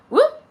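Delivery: very high loud whoop as if falling; cartoon whoop of a fall; slip yelp. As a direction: yelp like your feet went out from under you. Acting out a slip.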